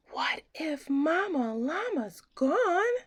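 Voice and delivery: high, frightened whisper; scared whisper. A narrator's reading. Baby llama afraid mama's gone, high scared whisper. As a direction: breathe it out in fear.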